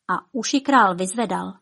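plain reading voice

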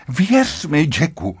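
high urgent appeal, falling on the name; desperate pleading. A narrator's reading. Wife clings to husband begging, desperate pleading. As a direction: implore him, crying out the first words. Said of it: "začala šíleně prosit".

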